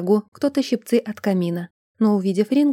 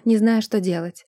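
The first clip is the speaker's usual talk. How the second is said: ordinary delivery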